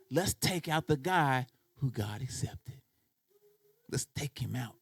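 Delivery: low, soft, slow scheming voice; sinister whisper of temptation. Acted. The feeling tempting Cain to murder, low and scheming. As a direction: hush it like a tempter plotting.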